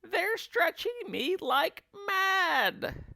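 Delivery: put-on high, slow, drawn-out old woman's cry; Missus Twit crying out. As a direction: stretch every word out, delighted. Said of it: "cried Missus Twit".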